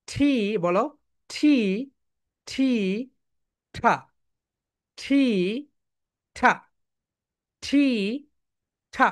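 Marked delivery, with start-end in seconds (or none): none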